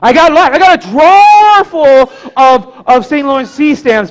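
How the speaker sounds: high, loud acted outburst, one word stretched; emphatic exclamation. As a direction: boom it out to the kid, drawing out DRAWER. Grandpa exclaims about his hoard.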